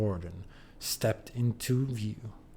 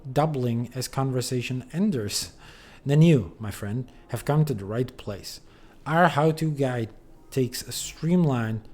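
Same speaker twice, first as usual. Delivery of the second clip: cheesy ad read; 3 semitones higher, 3 dB louder than usual. Acted. Reading a silly dating guide aloud, hammy ad voice. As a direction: sell it like a late-night infomercial.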